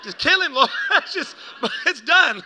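high, loud, laughing through the words; mock-excited plea bursting with laughter; amused mock eagerness. Joking as mom begging God to finish him off, laughing and excited.

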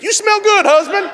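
high, loud mock compliment; overdone compliment. Wife complimenting husband on his smell, clumsily overdone. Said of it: "Maybe don't say it like that".

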